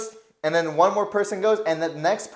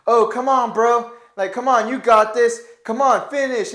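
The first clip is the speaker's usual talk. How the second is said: mock encouraging; 6 semitones higher, 6 dB louder than usual. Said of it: "we went from ... And this to".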